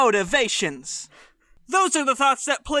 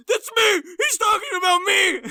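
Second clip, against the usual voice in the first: excited squeal; 10 semitones higher than usual. Retold or imitated. Aiden watching the special at home, high teary squeal.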